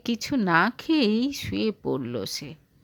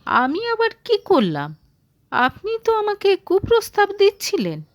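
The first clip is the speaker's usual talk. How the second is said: stunned; 10 semitones higher, 4 dB louder than usual. Acted. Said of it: "হতবাক হয়ে বলে".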